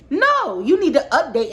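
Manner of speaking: a high, loud flat refusal; sharp no. Real, not acted. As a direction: cut him off hard. Angry correction to manager.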